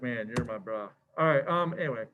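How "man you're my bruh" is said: soft, low, fond; affectionate bro. Retold or imitated. Compiler reassuring the coder, soft and fond. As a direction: say it like a hug. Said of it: "It's like".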